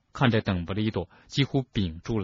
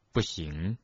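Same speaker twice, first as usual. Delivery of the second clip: low, soft, brief refusal; 5 semitones lower, 6 dB quieter than usual. A narrator's reading. Headmaster refuses to let Harry out, low and soft. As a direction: refuse quietly in a word. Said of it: "邓布利多简短地说".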